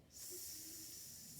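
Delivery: "s" as a long sustained hiss; letter sound S. Shown as a demonstration. Teacher showing what S makes, "s" as a long hiss. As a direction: draw out a lone "s" hiss. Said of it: "It makes the s sound".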